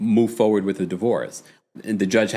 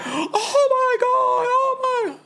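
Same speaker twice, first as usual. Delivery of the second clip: much higher slow mock gasp; mock shocked Marisol; much slower than usual. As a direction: stretch out a scandalized squeal. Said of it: "Marisol was like".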